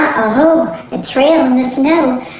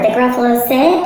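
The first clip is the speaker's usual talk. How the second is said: plain reading voice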